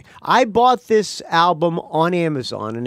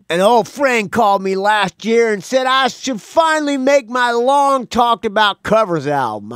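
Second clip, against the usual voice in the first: higher, mock drummer voice; Bunny voice; 6 semitones higher, 3 dB louder than usual. Shown as a demonstration. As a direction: read it in Bunny's voice. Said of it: "oh, so doing the Bunny voice".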